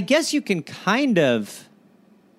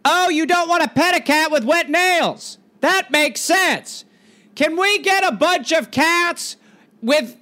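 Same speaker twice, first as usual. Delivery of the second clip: higher, carrying voice; calling over noise; 7 semitones higher than usual. Acted. Mayor ordering cats over the crowd, calling over noise. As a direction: project it over a din.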